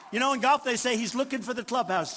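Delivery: ordinary talk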